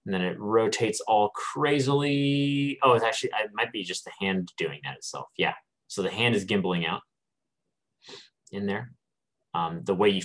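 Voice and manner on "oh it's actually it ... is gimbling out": usual speaking voice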